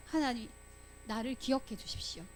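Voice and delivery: soft, humble plea to God; hushed, acted petition; quiet prayer. Quotes Nehemiah's closing prayer.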